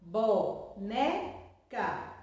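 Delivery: "Boneca" very slowly as bo, ne, ca, with gaps; syllable by syllable. Shown as a demonstration. Teacher showing pupils the syllables, "Boneca" slowly broken into bo-ne-ca. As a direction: split "Boneca" into three separate syllables. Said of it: "elas estão separadas".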